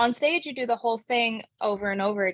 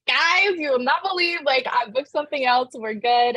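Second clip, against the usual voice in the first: high, loud burst of good news; gleeful news-breaking, pitched way up; retold excited announcement; 5 semitones higher, 4 dB louder than usual. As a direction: announce it to the whole family, reliving the thrill. Retells announcing news to family.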